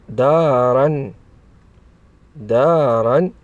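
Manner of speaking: slow, drawn-out dictation of a word; slow enunciation. Shown as a demonstration. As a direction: stretch each syllable for young learners. Teacher sounds out example word. (نطق بطيء ممدود للتعليم)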